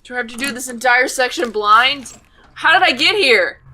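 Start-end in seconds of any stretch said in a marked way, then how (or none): 2.5-3.6 loud, high startled question; baffled outcry. Real, not acted. Player lost in a blind section, baffled outcry. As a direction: burst out in raised-pitch confusion. Baffled by sudden game scene.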